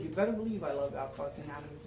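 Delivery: ordinary talk, nothing marked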